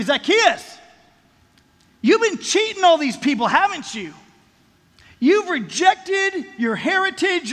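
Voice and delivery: high, loud accusation; accusing. Pretend. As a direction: accuse him of betraying his people. Imagined accusation of Zacchaeus.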